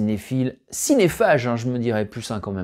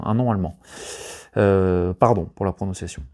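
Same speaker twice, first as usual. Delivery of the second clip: normal talking voice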